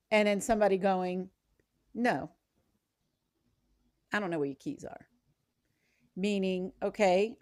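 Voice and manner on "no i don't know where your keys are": softer, lightly put-upon and irritated no; sulky denial, trailing off quietly; aggravated. Retold or imitated. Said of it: "I'm aggravated with you".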